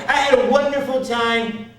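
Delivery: high, emphatic character voice; Marla. Retold or imitated. Woman telling the comic about the show, high eager imitation. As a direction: imitate her gushing, pitch well up. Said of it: "a thirty-year-old woman with Down syndrome".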